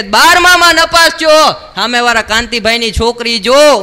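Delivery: a furious dad hammering the failed exam; angry father. Retold or imitated. Father scolding his son for failing twelfth grade, loud angry rant. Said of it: "મારા પપ્પા ખીજાણા".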